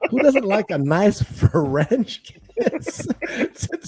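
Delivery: laughing. Real, not acted. Joking with a friend about kissing, laughing. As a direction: laugh through the words.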